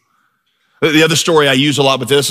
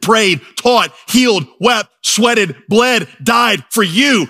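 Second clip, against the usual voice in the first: high, slow litany, each word set apart; impassioned preaching; 6 semitones higher, much slower than usual. Real, not acted. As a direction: hammer out each item in a raised preacher's cadence. Preacher builds through Puritan quotation.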